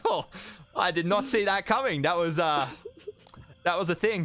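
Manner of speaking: high, astonished character voice; high and haltingly amazed; Kenna. Acted. Kenna reacting to the exploded demon, high and astonished. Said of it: "I was like".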